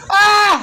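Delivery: a sudden high, loud yelp of fake pain; mock pain yelp. Uncle yelps as the little boy pretends to punch him: mock pain yelp. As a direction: yelp like you just got hit, hamming it up.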